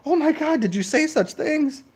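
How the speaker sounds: very high, gasping mock outrage; scandalized prude. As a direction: clutch your pearls in falsetto.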